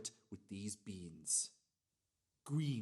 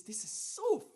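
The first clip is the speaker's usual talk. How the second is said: pitched up, giddy with delight; gleeful gush; 9 semitones higher, 4 dB louder than usual. Acted. Cook boasting to listeners about his beans, giddy and high. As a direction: bubble over at how easy it is.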